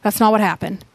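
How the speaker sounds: usual speaking voice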